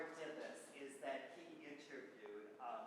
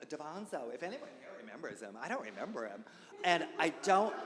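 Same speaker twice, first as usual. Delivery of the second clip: ordinary delivery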